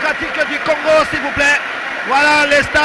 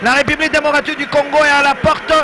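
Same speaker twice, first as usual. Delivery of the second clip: lively radio play-by-play; sports commentary; 5 semitones higher than usual. Real, not acted. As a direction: call the match like a radio commentator. Live match commentary begins.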